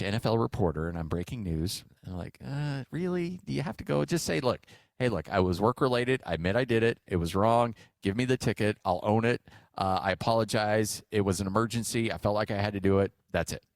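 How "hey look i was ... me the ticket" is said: ordinary talk, nothing marked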